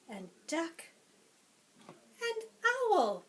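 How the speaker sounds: lively, sing-song picture-book read-aloud; reading to children. Acted. Teacher reading a picture book to preschoolers, lively and sing-song. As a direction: read it to a class of little ones.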